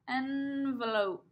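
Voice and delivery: Envelope said slowly and deliberately; slow repeat. Shown as a demonstration. Teacher repeating the word slowly for preschoolers. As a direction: draw the word out for little ears. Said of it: "teacher repeats slowly".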